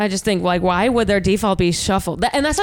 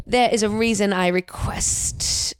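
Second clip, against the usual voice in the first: put-on very slow, villainous British accent; badly faked British accent, drawn out like a villain; 4 dB quieter, slower than usual. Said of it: "Is it Cruella de Vil?".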